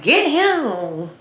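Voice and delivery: high-pitched, drawn-out teasing call; playful kitchen taunt. Retold or imitated. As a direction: toy with them in a high voice. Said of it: "I'm literally talking just like this".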